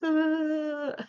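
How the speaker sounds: high, loud crying wail; scared wail. Retold or imitated. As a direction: wail like a terrified kid. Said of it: "anime waterworks".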